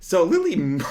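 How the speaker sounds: laughing while talking; cracking up mid-sentence. Real, not acted.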